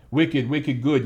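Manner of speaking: put-on a weak stab at a Boston accent; a half-hearted Boston accent; bad Boston accent. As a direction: try a Boston accent and miss. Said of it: "I can't do a Boston accent to save my damn life".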